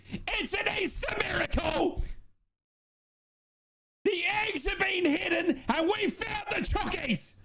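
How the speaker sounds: screaming high with joy, voice breaking; ecstatic full-time scream. Real, not acted. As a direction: roar the win like a miracle. Final whistle, team wins.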